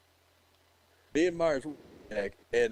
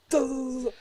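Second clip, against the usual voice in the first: mock gunfire; 4 dB louder than usual. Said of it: "Those sound effects".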